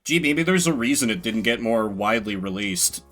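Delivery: ordinary talk, nothing marked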